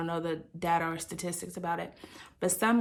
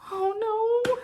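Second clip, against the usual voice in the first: dismayed oh no; 3 dB louder than usual. Retold or imitated. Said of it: "I was like".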